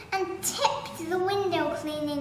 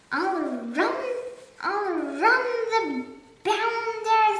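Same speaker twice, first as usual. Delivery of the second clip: higher, louder furious threats; blustering rage; 3 semitones higher, 4 dB louder than usual. Acted. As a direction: bluster like an old colonel. Duke raging at the burglar.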